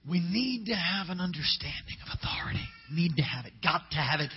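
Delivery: whispered, urgent conviction; hushed emphasis on need; intense whisper. Real, not acted. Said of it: "sermon point whispered intensely".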